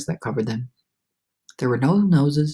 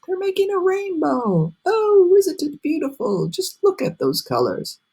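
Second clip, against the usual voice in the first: much higher, thrilled voice; delighted wonder; 14 semitones higher than usual. A narrator's reading. Travelers admiring the rainbow, thrilled. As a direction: marvel at the colors.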